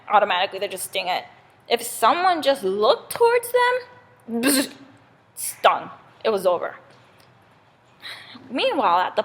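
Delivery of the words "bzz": short imitated bee buzz. Bee stinging anyone who looks, quick buzz.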